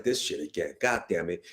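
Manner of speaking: low, soft grumble under the breath; low exasperated mutter; muttered annoyance. Retold or imitated. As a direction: mumble it like you're fed up. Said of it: "I was like".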